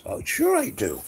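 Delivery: high-pitched retold eagerness; eager acceptance. She eagerly accepts more work.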